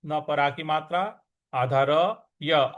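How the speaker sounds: ordinary talk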